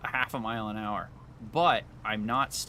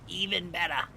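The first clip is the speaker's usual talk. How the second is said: silly high voice; 7 semitones higher than usual. Pretend. Repeats in higher voice.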